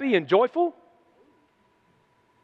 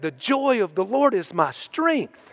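normal talking voice

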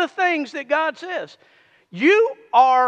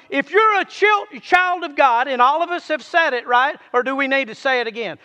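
normal talking voice

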